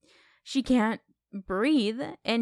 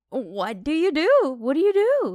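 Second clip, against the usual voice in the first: rising panic, voice climbing high and loud; frantic; 6 semitones higher, 6 dB louder than usual. Retold or imitated. Brother asking himself after the crash, in rising panic. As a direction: spiral into helpless panic.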